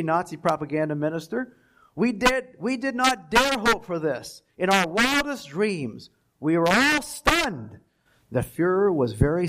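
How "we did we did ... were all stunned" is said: higher, slowing toward the end, half-stunned delight; gloating. Retold or imitated. Lecturer voicing Goebbels' disbelief at victory, hushed gloating. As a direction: marvel at your own luck, savoring it. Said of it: "gloated Goebbels".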